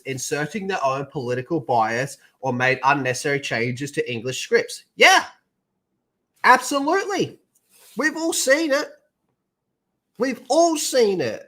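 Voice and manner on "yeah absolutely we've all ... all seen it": sarcastic agreement. Pretend.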